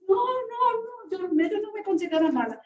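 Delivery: imitating a little girl, emphatic; little girl denying. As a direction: play the girl denying it quickly. Child voiced in retold story.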